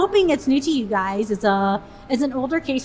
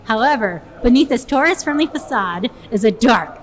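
louder, storyteller true crime intro; dramatic narration; 7 dB louder than usual. Acted. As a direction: build it up like a spooky narrator. Reads the case intro dramatically.

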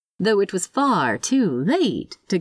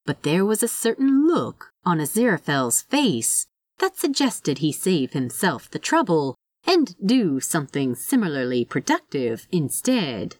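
usual speaking voice

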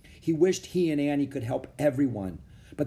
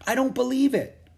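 high, louder disbelief; amazed exclamation; 13 semitones higher, 5 dB louder than usual. A narrator's reading. Annie reacts to hearing of the academy, amazed. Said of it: "exclaimed Annie".

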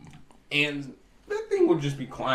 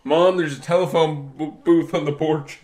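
loud, higher mock call for mom; 4 semitones higher, 8 dB louder than usual. Kid tells mom of a giant on the porch, calling out. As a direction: holler it through the house, playing the startled kid.

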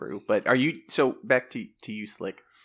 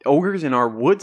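alarmed surprise; 2 semitones higher, 8 dB louder, much slower than usual. Acted. Father alarmed at ogre news.

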